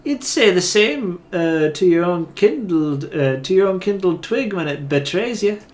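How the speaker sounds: put-on Isilmir's accent. Awakened soul defends the insults, loud Scots-style accent. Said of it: "Isilmir's accent".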